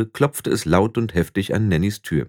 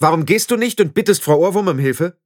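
high, louder outburst; snapping at Anagramma louder than intended; sharper than meant; 10 semitones higher, 3 dB louder than usual. Acted. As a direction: let it slip out too loud. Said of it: "lauter als beabsichtigt geklungen".

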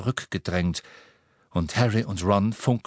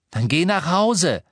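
raised, louder, heated voice; angry retort; 8 semitones higher, 5 dB louder than usual. A narrator's reading. Harry telling Ron to leave, angry raised retort. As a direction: throw it back at him angrily.

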